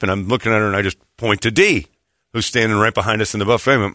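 normal talking voice

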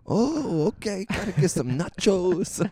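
high, slow mock Hawaiian voice; Charlie impression. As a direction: ham up a goofy high accent. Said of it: "Sound like Charlie from Always Sunny".

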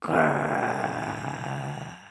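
deep low growl; dog growl. Acted. Dogs chasing monkey, deep growl.

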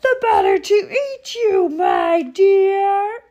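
very high, loud roar of attack; full-force villain's shriek; wolf's triumphant cry. A narrator's reading. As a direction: drop all pretence and pounce. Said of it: "cried the wolf".

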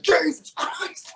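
high, loud, drawn-out yelp; hammed-up shriek of alarm; re-enacted startled yell. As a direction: cry out as if a bear just appeared. His cry on seeing bear, retold.

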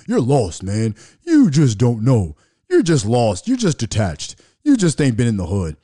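loud mocking scold from family; mocking cousins. Retelling cousins scolding him, loud mocking voice. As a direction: throw their dismissive scolding back, loud.

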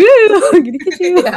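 high, loud spooky tease; playful monster chasing kids. Pretend.

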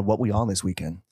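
ordinary talk, nothing marked

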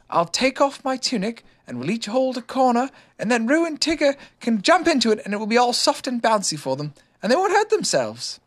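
lightly voiced eager boy; boy's voice. A narrator's reading. Christopher Robin voiced high.

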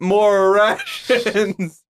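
high, slow, drawn-out robotic drone; game character voice. Pretend. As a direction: stretch it out like a video game NPC. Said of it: "You sound like my RimWorld characters".

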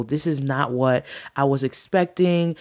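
ordinary talk, nothing marked

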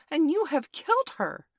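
high, loud, anguished accusation; accusing cry. A narrator's reading. Twin turning on Toodles over Wendy, accusing.

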